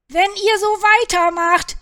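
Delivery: high, loud scolding; shouted warning. A narrator's reading. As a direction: yell a warning at the squabblers. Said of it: "schrie Caillou".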